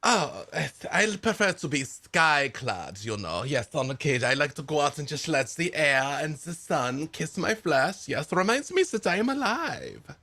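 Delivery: chatty eccentric woodsman, pleased with himself; lightly German-accented cheerful stranger; Albrecht the nudist. Acted. GM voices Albrecht NPC.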